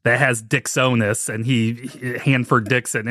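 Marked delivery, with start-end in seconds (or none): none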